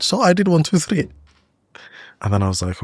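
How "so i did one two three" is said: the son listing, pitched much higher; a kid rattling off what he did, in a high voice; imitating his son. Son voiced in retold story.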